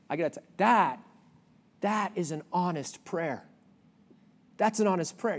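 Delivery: ordinary talk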